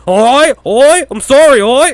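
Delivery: put-on high, loud Australian-accented yelps; mock Australian shouting. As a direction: heckle in a hammed-up Australian accent. Mocking the Australian critics.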